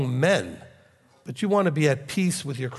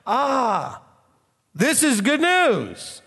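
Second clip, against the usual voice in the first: delighted exclamation; 9 semitones higher, much slower than usual. Real, not acted. Exclaims in delight after verse.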